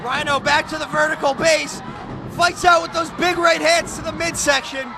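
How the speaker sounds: normal talking voice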